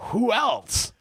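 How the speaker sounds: high-pitched incredulous question; retold disbelief. Teller reacts to Jeff wanting someone else, mock incredulous. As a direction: act baffled, pitched well up.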